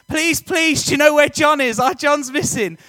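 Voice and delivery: high, loud, desperate Liverpudlian plea; pleading Liverpudlian mum. Retold or imitated. Mum begging her son's friends in the park, high pleading Liverpudlian voice. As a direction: beg them like a frightened Liverpudlian mother. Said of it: "She's got a Liverpudlian accent".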